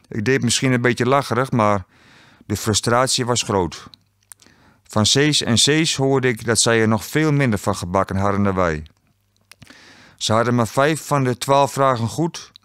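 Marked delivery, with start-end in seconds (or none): none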